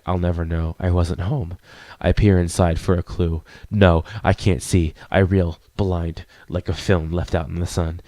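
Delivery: low, measured poetic recitation; poem reading. Acted. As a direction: read it as a brooding, solemn poem. Reads poem aloud.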